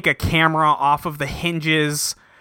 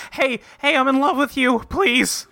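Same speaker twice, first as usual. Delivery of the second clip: higher, lightly voiced pleading woman; a mock lovesick plea, pitched up; mock pleading Queen; 9 semitones higher than usual. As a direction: beg him, a touch hammed-up. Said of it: "Queen catches up to him, is like".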